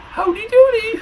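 very high squeaky greeting. A narrator's reading. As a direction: chirp it in a squeaky voice.

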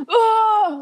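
high, loud startled oh; a classmate's sudden outcry; startled exclamation. Retold or imitated. Classmate exclaiming in retold story.

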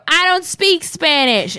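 yelled high yeehaw then hammy country drawl. Retold or imitated. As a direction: whoop it up like a wild gator-farm cowboy. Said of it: "you'd go".

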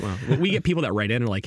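ordinary delivery

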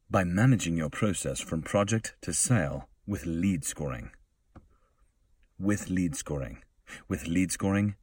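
polished promo voice-over; commercial read. Acted. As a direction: pitch the product to business partners. Reads a software ad script.

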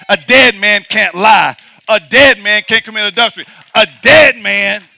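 loud, driving preacher's cadence; full-force preaching. Acted. Preacher driving home to the congregation that a dead man can't sin, loud and forceful. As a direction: hammer each line home from the pulpit.

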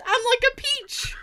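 high, dainty mock-fragile voice; mock delicate. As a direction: play the delicate little peach. Mock delicate self-pity.

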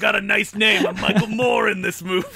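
shouted raspy villain voice. Retold or imitated. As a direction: bark it out with a rasp. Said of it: "raspy voice, and he talks like this".

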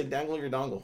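tongue held, words mangled; holding tongue with fingers. Shown as a demonstration. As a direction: say it while pinching your tongue. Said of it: "Hold, hold your tongue and say".

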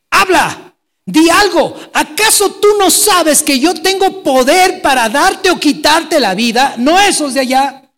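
furious Pilate, high and loud, slow; angry shout. Retold or imitated. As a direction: bark it like an enraged governor demanding an answer. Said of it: "Y se enoja". (Pilato furioso grita a Jesús, muy alto y fuerte)

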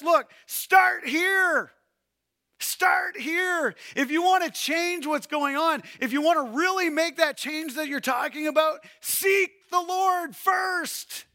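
high, loud, slow insistence; urgent shout. Real, not acted. Preacher urging the congregation loudly to seek the Lord first. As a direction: drive it home with full voice.

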